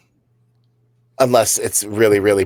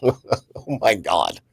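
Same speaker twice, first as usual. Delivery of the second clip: laughing; 5 semitones lower, 5 dB quieter, much slower than usual. Real, not acted. Laughing at the coworker's reaction.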